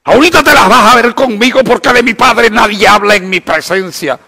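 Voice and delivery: mock indignant outburst. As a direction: ham up a touchy son's outrage.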